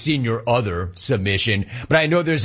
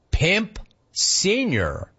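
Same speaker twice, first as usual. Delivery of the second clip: drawn out; 7 semitones higher, much slower than usual. Real, not acted. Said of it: "Stop you right there".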